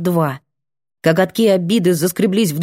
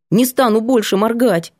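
ordinary delivery, nothing marked

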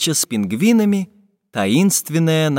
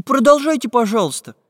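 Прутик asking politely; 10 semitones higher than usual. A narrator's reading. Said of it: "попросил прутик профессора Пыхтелкина".

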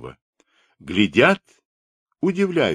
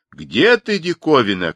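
raised, higher crowd call; shouting; 6 semitones higher, 4 dB louder than usual. A narrator's reading. Cossacks calling for the curiosity, shouting. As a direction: call out loudly as a searching crowd. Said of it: "кричали казаки".

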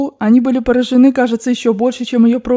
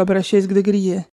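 ordinary delivery, nothing marked

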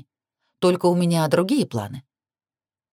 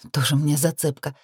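plain reading voice

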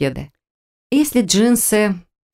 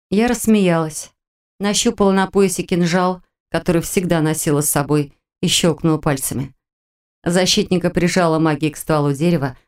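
usual speaking voice

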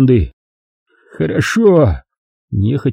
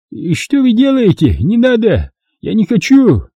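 high, rising panic; panicked plea; 10 semitones higher than usual. A narrator's reading. Merchant begging the men tying him, panicked. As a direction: beg them to stop.

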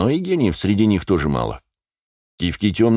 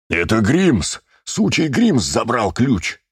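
протяжный гневный выкрик повыше; взреви от злости, растягивая слова; внезапный рёв; 5 semitones higher, much slower than usual. A narrator's reading. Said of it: "неожиданно взревел Вилли". (яростно ревёт)

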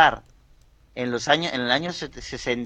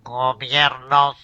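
slow, halting machine voice; a weak try at a speech synthesizer; much slower than usual. Pretend. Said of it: "yo imito bastante mal al festival".

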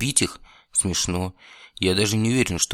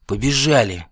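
loud roared command, higher; roaring; 4 semitones higher, 6 dB louder than usual. Acted. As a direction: bellow to run for it. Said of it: "взревел вампир". (рёв вампира)

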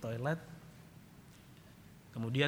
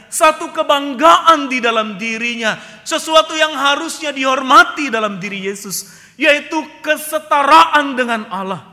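loud, high, ringing sermon cry; full-force preaching; 12 semitones higher, 17 dB louder than usual. Acted. Preacher crying out to the congregation, loud and high. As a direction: preach it out to the whole hall.

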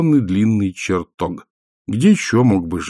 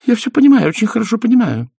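much higher, haughty wife's voice; 13 semitones higher, 4 dB louder, faster than usual. A narrator's reading. Wife to Death before leaving, haughty and high. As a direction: look down on Death as you say it. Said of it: "высокомерно сказала она".

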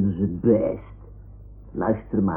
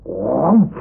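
monster voice; 4 semitones higher, 6 dB louder than usual. Acted. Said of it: "Hij zei".

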